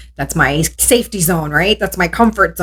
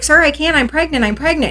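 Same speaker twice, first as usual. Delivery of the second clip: high, urgent plea; frantic pleading; 9 semitones higher than usual. Retold or imitated. Pregnant woman begging the officer on the floor, panicked plea. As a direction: beg him to stop, panicked.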